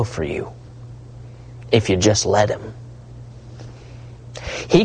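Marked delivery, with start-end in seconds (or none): none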